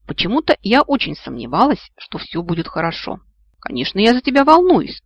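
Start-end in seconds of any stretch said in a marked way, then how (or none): 3.6-5.0 high, loud indignant protest; indignant retort. A narrator's reading. Friend to a dangling girl, indignant protest. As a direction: protest as if offended by the question. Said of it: "возмутился тот".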